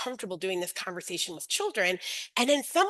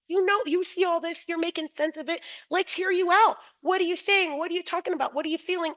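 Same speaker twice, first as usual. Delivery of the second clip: put-on higher, eager voice inviting a child to talk; encouraging adult; 7 semitones higher than usual. Teacher inviting a child to share, higher and encouraging. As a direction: coax a child to open up.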